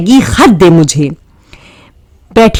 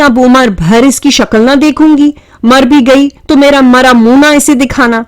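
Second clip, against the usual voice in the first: mimicking mother; 6 semitones higher than usual. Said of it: "منہ بنا بنا کر امی ہی کے انداز میں".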